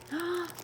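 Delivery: quick soft gasp of delight. Real, not acted. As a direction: catch your breath in delight. Sees another gift.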